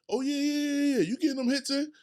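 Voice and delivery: high mocking taunt. Speaker taunts life landing punches, high and mocking. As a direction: goad the puncher while leaning on the ropes. Mocking the hitter, rope-a-dope.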